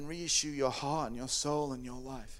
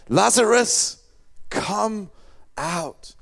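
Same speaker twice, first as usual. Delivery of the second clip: raised, booming call, easing off on the last word; loud command; 4 semitones higher, 9 dB louder, much slower than usual. Acted. Jesus summons Lazarus from the cave, loud command. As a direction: call out the words one at a time. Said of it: "called out in a loud voice".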